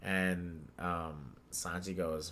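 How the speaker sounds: normal talking voice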